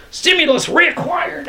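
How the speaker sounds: very high bird voice; high-pitched copied yell; echoing cry. A narrator's reading. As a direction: echo the call high and excited. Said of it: "echoed Thomas".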